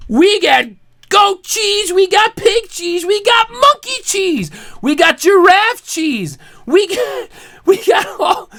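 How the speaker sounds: high, excited rattling off of a list; escalating mock boast. Ranter listing ever fancier animal cheeses, mounting excitement. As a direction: build up an absurd menu, pitched high.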